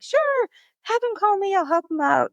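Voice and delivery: high, sing-out retold yes; breezy agreement. Runner replying to a friend's request, breezy and high. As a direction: agree readily, pitched well up.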